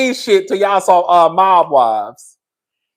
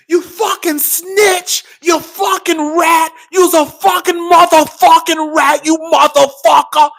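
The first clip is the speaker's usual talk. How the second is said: high, slow, crying curses; sob-scream the insults; tearful rage; 8 semitones higher than usual. Retold or imitated. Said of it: "used to curse each other out".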